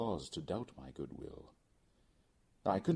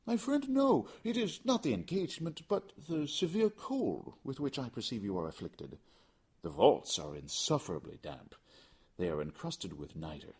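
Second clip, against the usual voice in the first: high voice, dropping back down; Montresor, false concern; 8 semitones higher than usual. Acted. Montresor feigning worry about the damp vaults, high. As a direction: pretend to worry for him.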